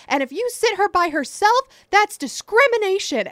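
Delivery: high, loud retold screaming; mock screaming. Passenger screaming at flight attendant, high loud mock rant. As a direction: yell it like the raging passenger. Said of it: "just screaming and freaking out".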